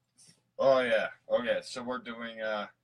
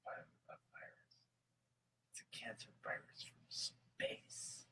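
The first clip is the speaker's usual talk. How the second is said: conspiratorial whisper; 17 dB quieter than usual. Pretend. Host telling a conspiracy tale in a spooky hush. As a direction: whisper it like a secret from space.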